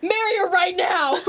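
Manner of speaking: high, loud, slow command cracking into laughter; laughing command. Acted. Cyrus kicking Brock and laughing, high and loud. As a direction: shout it while laughing.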